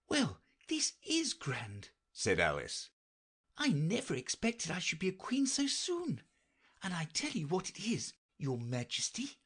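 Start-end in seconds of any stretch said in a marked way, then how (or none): 0.1-1.9 put-on high, light girlish voice; Alice voice. A narrator's reading. Alice to herself, dreaming of being queen, in a high girlish voice. 3.6-9.4 put-on high, light girlish voice; Alice voice. A narrator's reading. Alice to herself, dreaming of being queen, in a high girlish voice.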